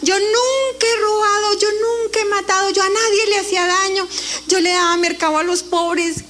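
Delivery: high, pleading outcry; desperate protest. Retold or imitated. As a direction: protest your innocence in panic. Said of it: "yo empecé a gritar". (protesta desesperada y aguda)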